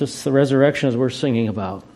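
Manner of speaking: normal talking voice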